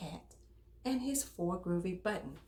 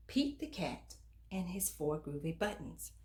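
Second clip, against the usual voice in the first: ordinary delivery, nothing marked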